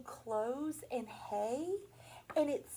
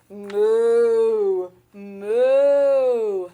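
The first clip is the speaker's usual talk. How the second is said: very loud booming moos; cow moo; 3 semitones lower, 16 dB louder than usual. Pretend. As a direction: bellow it like a big cow. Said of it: "Who makes that sound, moo? A cow does".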